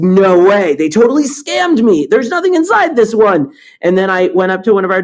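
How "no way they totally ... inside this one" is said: high, louder mock indignation; 空っぽの聴診器に憤慨して高く大きめの声で; mock outrage. Retold anger over hollow stethoscope.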